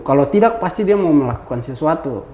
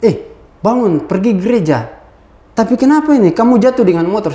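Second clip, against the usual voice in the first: lightly voiced mother, higher pitched; retold motherly fussing, pitched up; mother's questioning; 6 semitones higher, faster than usual. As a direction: wake your son, then question him in alarm. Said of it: "Ibu saya tanya".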